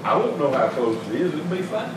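usual speaking voice

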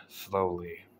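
plain reading voice

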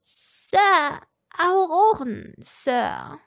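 high, nervous little servant voice; uneasy servant. A narrator's reading. Servant Egolina warning her master, high and uneasy. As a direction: squeak it out uneasily. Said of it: "Egolina wirkte Unbehagen".

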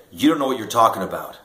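half-acted indignant outburst; retold angry challenge. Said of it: "And he said".

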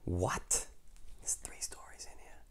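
hushed whisper; breathy hushed aside. Real, not acted. Storyteller whispering to listeners before starting.

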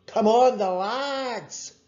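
loud, high cheer; big drawn-out supporter's shout; roaring. A narrator's reading. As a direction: roar on your team. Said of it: "roared Angus".

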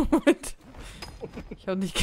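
laut lachend, höher. Real, not acted. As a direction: lacht über das eigene Missgeschick. Gegen Wand gelaufen.

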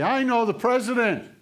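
high, loud mock announcement; calling out. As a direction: holler it as if to get past the gate.

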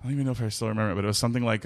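ordinary delivery

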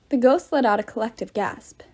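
ordinary delivery

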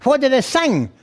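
high nasal question; imitating her in a high nasal voice; nasal wife. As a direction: ask through your nose as the wife. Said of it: "My wife talks through her nose".

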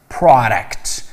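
پروداکت said with an open "a", pra-dekt, not pro-; correct pronunciation. Shown as a demonstration. Teacher correcting students' pronunciation, slow and clear. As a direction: give the right sound, pra-dekt. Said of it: "تلفظ صحیح این واژه آ هستش".

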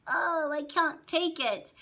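high, drawn-out wail of giving up; mock helpless whine. Mimicking someone who folds up like a little flower, helpless whine. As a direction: wilt dramatically on a high, slow whine. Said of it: "folding up into a little flower".

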